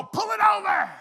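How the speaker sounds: high, loud challenge to the trucker; mock shout. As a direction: yell at him to pull over. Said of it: "I wants to go".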